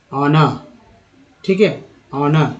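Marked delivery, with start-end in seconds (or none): 0.1-0.6 "ऑनर" starting straight on the vowel, no h; silent H. Shown as a demonstration. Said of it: "एच actually silent है". 2.1-2.5 "ऑनर" opening on the vowel without h; silent H. Shown as a demonstration. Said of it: "एच का उच्चारण यहाँ पर अ के रूप में".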